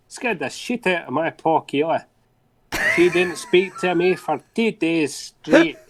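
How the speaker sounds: storytelling in a put-on Scottish accent. Shown as a demonstration. Co-host reading a listener's mishap to the host, Scottish accent. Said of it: "You do a good Scottish accent".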